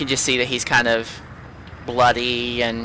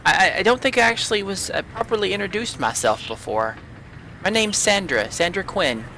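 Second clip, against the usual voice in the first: put-on higher, hesitant girl voice; shy young cleric; 8 semitones higher than usual. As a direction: stammer it out nervously, pitched up. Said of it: "She actually walks over to you guys".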